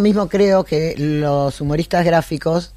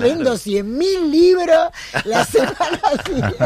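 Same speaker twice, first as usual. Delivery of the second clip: higher and higher, breaking into laughter; exuberant bravado; 8 semitones higher than usual. Real, not acted. Cartoonist jokes about going to jail, exuberant bravado.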